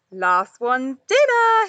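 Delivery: loud, slow teasing challenge; playful wink. A narrator's reading. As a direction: tease them into the water. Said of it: "he winked".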